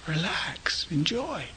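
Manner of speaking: whispered relax, enjoy. Acted. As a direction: breathe the two words softly. Gentle reminder to meditation retreat.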